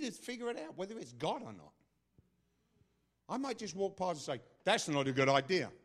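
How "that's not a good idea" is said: loud, blunt verdict; stern prophet voice. Acted. Prophet correcting someone while walking past, stern and loud. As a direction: deliver it as a forceful rebuke. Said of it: "when that voice comes, you get scared".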